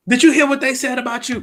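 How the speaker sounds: high, loud gossip's challenge; acted-out loud, high questioning; confronting busybody. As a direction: pounce on them with the news. Acting out someone confronting the worshiper.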